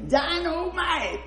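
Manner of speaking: high, slow, drawn-out "Dynamite!"; hammed-up teacher exclamation; teacher's shout. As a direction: stretch "Dynamite!" out like a startled teacher. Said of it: "She said".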